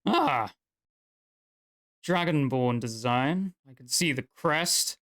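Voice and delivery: a hint of an appraising old craftsman; lightly voiced dwarf smith. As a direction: admire the work like an old smith.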